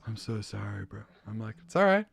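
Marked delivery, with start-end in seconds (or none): 0.1-1.0 hushed, embarrassed mock apology; mortified. Fan apologizes for mistaking him, imitated mortified mumble. 1.7-2.1 high, loud "no worries"; retold breezy reassurance. Reassuring the embarrassed fan, high mock-breezy.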